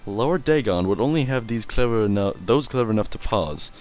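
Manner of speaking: normal talking voice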